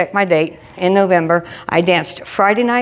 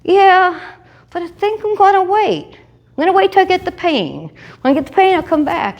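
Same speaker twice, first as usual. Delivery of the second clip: high breezy; 11 semitones higher than usual. Retold or imitated. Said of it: "I said".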